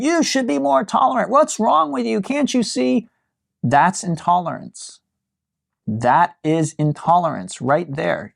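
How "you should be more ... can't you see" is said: much higher, a hammed-up scolding shriek; mock yelling. Teacher mimicking someone yelling at the intolerant, mock shrill. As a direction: play the outraged preacher of tolerance, pitched way up. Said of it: "you start yelling and screaming at them".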